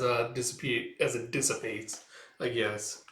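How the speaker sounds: ordinary talk, nothing marked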